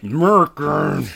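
American slurred slowly to "Murican"; American as a loud, slow "Murrkn"; dropped A. Shown as a demonstration. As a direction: drawl American loudly without its first A. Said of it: "Drop that A like a good American".

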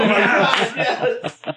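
high, loud gleeful yes; excited laughing yes. Real, not acted.

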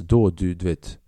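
ordinary talk

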